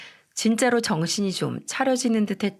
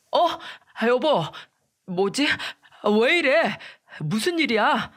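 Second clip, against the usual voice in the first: startled confusion; 5 semitones higher than usual. A narrator's reading. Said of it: "정신을 화들짝 차리면서".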